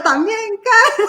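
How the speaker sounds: loud laughing mock shout of the taunt. Laughing while shouting the taunt people thought she meant. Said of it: "yo estaba haciendo el".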